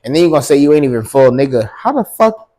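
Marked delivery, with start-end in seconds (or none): 1.8-2.5 incredulous. Real, not acted. Incredulous outburst.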